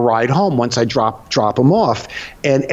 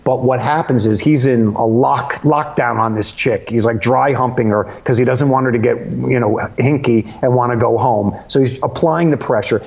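normal talking voice

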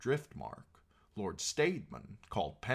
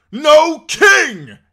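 short, high, full-force shout; last defiant cry; 25 dB louder than usual. A narrator's reading. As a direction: scream it as your dying protest. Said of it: "he cried again".

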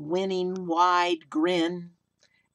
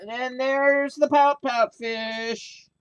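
drawn-out higher, louder reveal; excited reveal; 6 semitones higher, 7 dB louder, slower than usual. A narrator's reading. Storyteller to kids, slow excited reveal of the fish. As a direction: present the fish like a big surprise.

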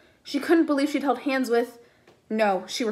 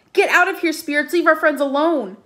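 higher, louder shout at the spirits; frightened yell; 5 semitones higher, 6 dB louder than usual. A narrator's reading. Frank shouting at the spirits, frightened. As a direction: yell them away, rattled.

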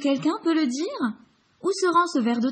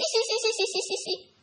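cicada chirp; 11 semitones higher than usual. Acted.

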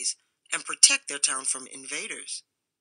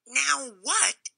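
cameo cutter puzzled; 8 semitones higher than usual. A narrator's reading. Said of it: "said the cameo cutter".

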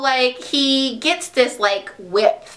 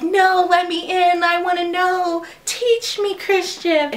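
higher, breathy-sounding mock plea; mock pleading Anastasia; 7 semitones higher, slower than usual. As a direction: plead like a starry-eyed girl, higher. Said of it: "And she's like".

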